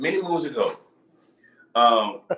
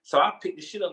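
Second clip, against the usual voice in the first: usual speaking voice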